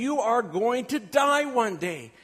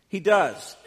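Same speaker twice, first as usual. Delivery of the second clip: ordinary talk, nothing marked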